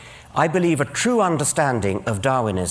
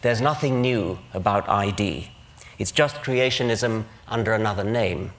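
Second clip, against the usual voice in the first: normal talking voice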